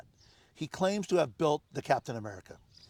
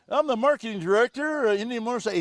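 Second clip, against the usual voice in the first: higher, louder, hammed-up self-important intro; mock marketing director; 7 semitones higher, 5 dB louder, faster than usual. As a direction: puff up like a proud marketing man. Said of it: "He's doing this".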